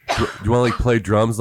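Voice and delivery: ordinary talk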